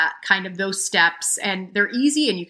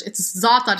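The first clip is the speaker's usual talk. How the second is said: ordinary talk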